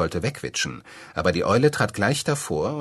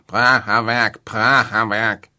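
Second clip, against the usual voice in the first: quacking duck; 4 semitones higher, 8 dB louder, slower than usual. A narrator's reading. Duck waddles off exclaiming.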